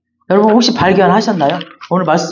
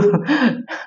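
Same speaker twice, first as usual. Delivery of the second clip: a quick soft chuckle; short laugh; 5 semitones higher, 6 dB quieter than usual. Real, not acted. Preacher laughing after teasing 김민우. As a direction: let a light laugh slip out.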